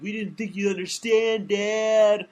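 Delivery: put-on pleading protest; mock protesting offspring. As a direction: stretch it into a loud complaint to a parent. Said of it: "and they're like".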